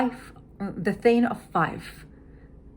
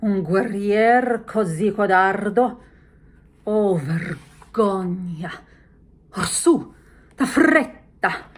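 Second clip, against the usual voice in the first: scornful shaming; 2 semitones higher, 9 dB louder, slower than usual. Shown as a demonstration. Lady Macbeth scorning and hurrying Macbeth. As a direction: shame him, then drive him on. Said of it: "she shames him".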